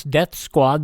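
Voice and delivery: ordinary delivery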